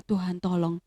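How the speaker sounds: soft, low murmured prayer over a friend; gentle whispered-down plea to God; hushed prayer. Retold or imitated. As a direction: pray quietly, hand on her chest. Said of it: "dan saya berdoa".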